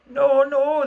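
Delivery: pleading no, high and loud; piteous cry. A narrator's reading. Boys plead on their knees, high piteous cry. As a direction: beg for mercy in a high wail. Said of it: "so piteously".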